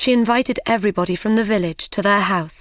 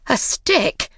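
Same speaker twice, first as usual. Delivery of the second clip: higher, scornful question; mother scoffing at a mere stick; angry disbelief; 5 semitones higher than usual. Acted. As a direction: throw the word back at him. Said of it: "cried his mother angrily".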